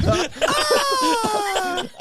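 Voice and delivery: high-pitched imitated shriek; mock scream. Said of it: "She was like".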